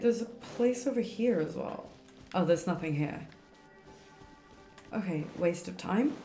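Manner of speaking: ordinary talk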